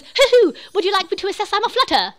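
high, loud hooting British owl; hoot, then ask brightly in mock British; British accent. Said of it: "He honestly has a British accent".

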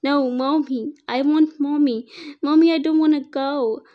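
put-on a small boy sobbing as he's taken away; child crying for his mommy. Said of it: "Dante cried".

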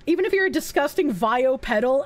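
ordinary talk, nothing marked

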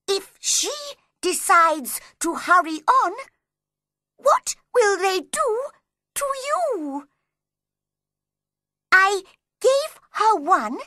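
high, measured animal-voice recital. A narrator's reading. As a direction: read the verse aloud in a high rabbit voice.

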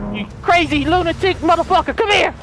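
a high yell at a fleeing man; chasing yell. Real, not acted. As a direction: bellow at him to stop running.

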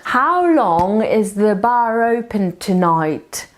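slow, with bar open linked as one word; slow linked model. Shown as a demonstration. Teacher showing learners how the words link. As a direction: run bar open together, slowly. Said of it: "Bar open mi się połączy w jedno słowo".

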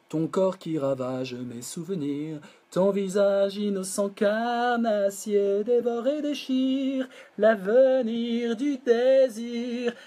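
drawn out, rising into a sung chant; slow recited lyric. Shown as a demonstration. Teacher reading the song lyric to the class, slow and chanted. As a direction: recite the verse slowly, lifting at the end.

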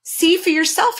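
dodecahedron voice. Acted.

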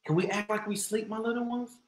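ordinary delivery, nothing marked

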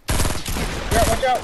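high urgent yell; warning shout. Real, not acted. Warning teammate in gunfight.

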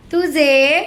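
"तुझे" held long with a rising end; leading prompt. Shown as a demonstration. Teacher feeding a pupil the first word, leading prompt. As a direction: hang on the end of "तुझे", inviting completion. Prompting child to continue the sentence.

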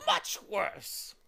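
high, loud bird voice; longing bird. A narrator's reading. Bird answering Milo longingly, high and loud. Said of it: "he said longingly".

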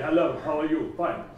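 a lightly mocked flat, robotic student voice; flat mechanical voice. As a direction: mimic a learner droning without melody. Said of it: "they put on this very mechanical, flat voice range".

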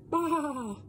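wavering, bleating baa like a sheep. Acted. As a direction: bleat it like a lamb. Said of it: "All I know is sheep go".

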